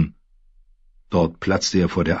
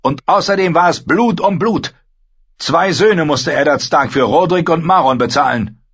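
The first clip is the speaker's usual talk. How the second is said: put-on higher, louder young lord ranting; raised, frustrated voice of an embattled lord; Theon, angry and anxious; 10 semitones higher, 5 dB louder than usual. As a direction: build up the grievance, then demand. Theon justifies.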